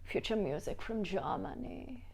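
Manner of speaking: soft, mock German accent; German accent impression. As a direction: play a quiet German music fan, accent on. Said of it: "Whenever I do an impression".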